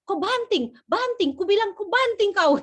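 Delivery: high, loud commands, sounding furious but isn't; Medan father shouting. Retold or imitated. Father directing his son into the garage, high loud shout. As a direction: boom the orders at the boy. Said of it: "kayak marah-marah".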